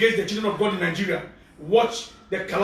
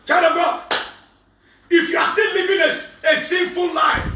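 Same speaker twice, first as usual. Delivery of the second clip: loud higher preaching cry on still and sinful; 6 semitones higher, 3 dB louder than usual. Acted. Pastor warning sinners to repent, loud preaching cry. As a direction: call out the sinners.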